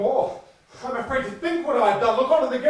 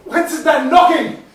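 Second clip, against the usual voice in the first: higher, louder start at a noise; startled; 5 semitones higher, 9 dB louder than usual. Acted. Husband startled by knocking, high and loud. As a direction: jump at the knock.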